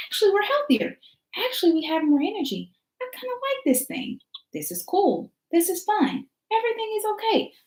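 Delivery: the body discovering it's fine; relieved body. Pretend. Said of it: "your body is like".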